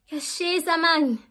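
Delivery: loud exclamation. Real, not acted. Exclaiming about the heat.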